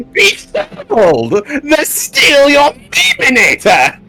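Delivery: very high, slow villain proclamation. Shown as a demonstration. Performing the co-host's requested line, high slow villain proclamation. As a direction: announce it grandly like a cartoon overlord. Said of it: "Okay, fine, I'll say it".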